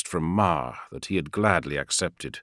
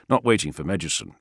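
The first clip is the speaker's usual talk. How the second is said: ordinary delivery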